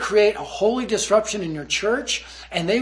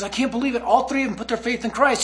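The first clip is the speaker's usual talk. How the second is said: put-on higher, amazed, overexcited kid; Josh; 5 semitones higher than usual. Kid reporting to the praying group, freaking out excitedly. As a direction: imitate a kid breathlessly reporting great news. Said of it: "he's freaking out".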